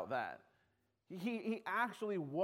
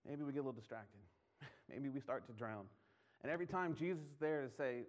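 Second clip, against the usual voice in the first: ordinary delivery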